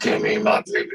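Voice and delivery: unvoiced demon impression of an enraged mother; demonic horror-movie voice. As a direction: imitate her as a possessed movie monster. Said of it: "Her eyes got red and demonic".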